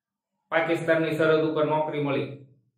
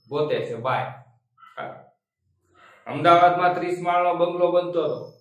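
normal talking voice

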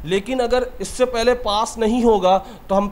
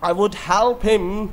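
slow example sentence; 2 semitones higher, much slower than usual. Shown as a demonstration. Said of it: "For example".

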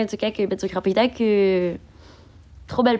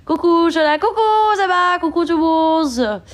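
high sing-song hello, louder; bright excited greeting; 7 semitones higher, 5 dB louder than usual. Real, not acted. Streamer greets her chat in a high, louder sing-song.

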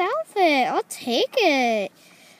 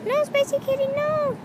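scolding kitty; 11 semitones higher than usual. Pretend. Scolding the toy kitten.

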